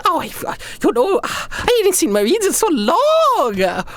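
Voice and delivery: very high, gushing and excited emperor voice; giddy young emperor. Acted. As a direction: squeal each greeting like a flustered young ruler. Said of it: "And he goes".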